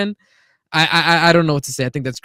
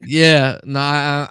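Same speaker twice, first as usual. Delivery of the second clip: ordinary talk, nothing marked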